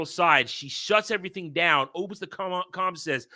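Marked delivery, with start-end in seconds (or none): none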